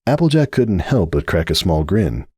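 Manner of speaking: usual speaking voice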